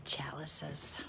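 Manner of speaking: chalices whispered softly; whispered chalices, then said aloud. Real, not acted. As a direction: breathe the word chalices in a hush. Whispered word then repeated aloud.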